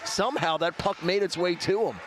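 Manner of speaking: live hockey play-by-play. Acted. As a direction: call the action live. Play-by-play of game.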